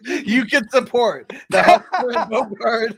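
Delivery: hammed-up charity commercial read; infomercial voice. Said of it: "it'll be an infomercial like that".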